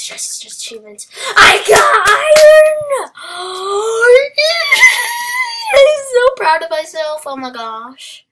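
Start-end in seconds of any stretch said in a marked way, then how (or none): 1.4-3.1 very high, loud, stretched-out victory yell; triumphant shout. Real, not acted. Gamer finally finding iron, triumphant shout. 3.2-5.7 long, very high, loud squeal of joy; triumphant squeal. Real, not acted. Gamer celebrating iron, long triumphant squeal. 5.7-7.2 high, loud squeal of pride; overjoyed shout. Real, not acted. Gamer proud of finding iron, overjoyed shout.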